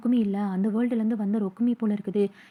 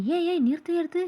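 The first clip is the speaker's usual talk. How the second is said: higher-pitched, halting protest; startled interruption; 7 semitones higher than usual. A narrator's reading. Keno stops Okkumi right after the love confession, startled. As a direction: cut in, pitch jumping up.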